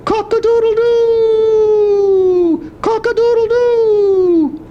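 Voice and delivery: high, loud, stretched-out rooster crow. Shown as a demonstration. Grandpa showing a grandchild a rooster crow. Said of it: "It sounds like this".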